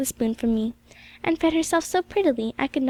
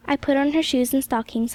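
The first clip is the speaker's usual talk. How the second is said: ordinary delivery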